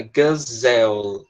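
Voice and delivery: Gazelle drawn out slowly, ending on a clear final L; slow pronunciation model. Shown as a demonstration. Teacher modeling the word slowly for the class. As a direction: stretch out Gazelle so the L at the end rings. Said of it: "tem esse som de L no final".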